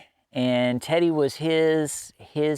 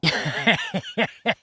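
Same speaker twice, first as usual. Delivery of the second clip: real laugh; 5 semitones higher than usual. Real, not acted. Laughs after don't judge joke.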